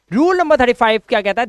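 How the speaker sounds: normal talking voice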